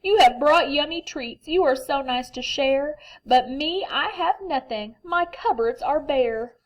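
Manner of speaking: a sorry-for-himself bear; mild sad lament. Acted. Bear laments having nothing.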